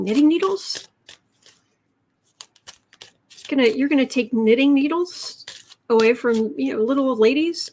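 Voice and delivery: a hint of a fussy old lady; little old lady voice. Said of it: "he did a little old lady vo".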